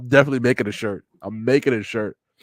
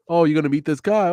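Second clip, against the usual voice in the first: usual speaking voice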